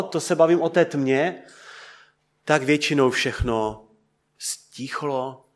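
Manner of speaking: ordinary delivery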